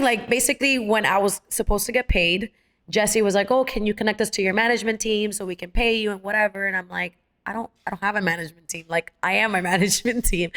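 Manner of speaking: usual speaking voice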